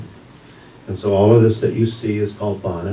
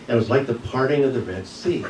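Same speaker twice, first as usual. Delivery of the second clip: ordinary delivery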